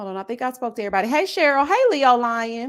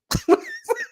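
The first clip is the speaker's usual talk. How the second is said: high burst of laughter. Real, not acted. Laugh after the song.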